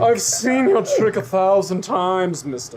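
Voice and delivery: slow, drawn-out camp complaint; Jerry. Acted. Townsman tired of the magician's trick, slow camp drawl. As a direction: drawl it like a weary, fussy townsman. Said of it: "kind of a little bit fruity".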